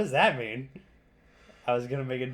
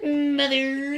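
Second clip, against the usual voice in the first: whiny wail; 11 semitones higher, 4 dB louder than usual. Pretend. Echoing mock wail.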